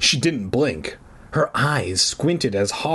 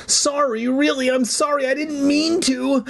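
high, pleading apology; hammed-up high wail of apology; crying out. A narrator's reading. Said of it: "I cried".

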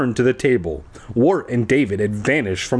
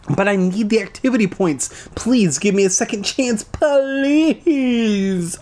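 begging; 10 semitones higher than usual. A narrator's reading. Boy begging Tasha for a second chance, pleading. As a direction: stretch the last please up high. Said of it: "I begged".

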